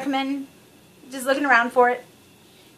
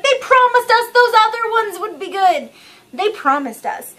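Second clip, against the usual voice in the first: higher, louder, indignant protest; indignant complaint; 8 semitones higher, 5 dB louder than usual. Retold or imitated. To friends who say it'll be good, loud indignant protest. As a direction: complain like a betrayed fan. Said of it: "And I'm like".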